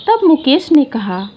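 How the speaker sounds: plain reading voice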